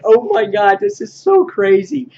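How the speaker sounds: lightly hammed-up amazement; amazed. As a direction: gush at how wild it is. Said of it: "I was just like".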